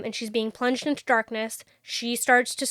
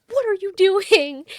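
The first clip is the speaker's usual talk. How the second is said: exasperated yell; 8 semitones higher, 8 dB louder than usual. Retold or imitated. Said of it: "he's like".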